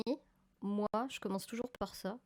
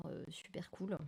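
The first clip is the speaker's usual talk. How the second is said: hushed aside; 9 dB quieter than usual. Real, not acted. Hushed aside about new scanner.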